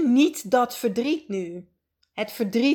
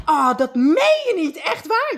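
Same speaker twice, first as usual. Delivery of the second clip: hoog, ongelovig en blij; blij verrast; 5 semitones higher, 3 dB louder than usual. Pretend. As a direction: reageer alsof je net de baan kreeg. Overjoyed at job offer. (gespeelde blije verrassing)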